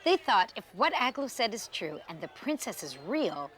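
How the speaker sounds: ordinary delivery, nothing marked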